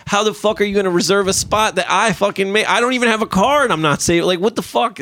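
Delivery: high, heated disbelief; furious rant. Real, not acted. Venting at neighbors saving his spots, pitched high and heated. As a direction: vent it like you can't believe them.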